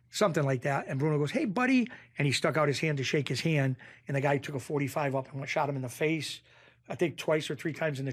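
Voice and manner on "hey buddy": high, imitated friendly reply; hearty greeting. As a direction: greet him like an old buddy. Acting out Bruno's friendly reply.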